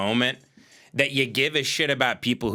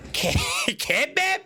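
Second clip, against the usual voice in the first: mock question. Mocking questioner in squeaky voice.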